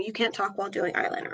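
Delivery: murmured fast while concentrating; quiet, quick, focused on the brush; applying eyeliner. Real, not acted. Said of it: "Sorry, I'm not talking".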